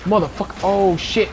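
high startled curse; sudden high panic; startled cursing. Real, not acted. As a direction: yelp it like you just got caught. Sudden danger in game.

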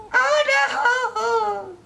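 high wailing oh no; real panic, cry it out high; panicked cry. Real, not acted. Losing in game.

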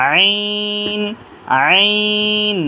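আইন stretched on a level note; drawn three counts. Shown as a demonstration. Teacher models a letter for learners, drawn long. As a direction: hold the "ee" glide of আইন long. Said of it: "তিন আলিফ টানবো".

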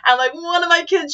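normal talking voice

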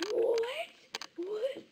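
imagined amazement. Pretend. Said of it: "I'd be like".

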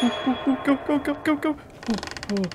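high urgent go go go; hurry, panicked urging; urging himself on. Real, not acted. Running back urgently.

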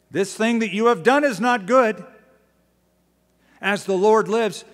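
loud, high rebuke called out. Acted. David rebuking Abner from afar.

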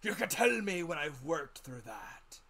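high, loud, slow commanding sailor's voice; fierce captain. A narrator's reading. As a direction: lay down terms like a fierce ship's commander. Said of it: "looking as fierce as a commander".

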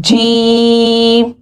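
जीम very slow, voice out through the mouth, not the nose; correct jeem. Shown as a demonstration. Teacher shows students the right जीम. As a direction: draw out a clean जीम. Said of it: "आवाज़ को बाहर निकालना है".

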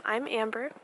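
ordinary talk, nothing marked

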